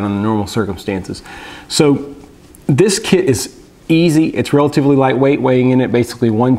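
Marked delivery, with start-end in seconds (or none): none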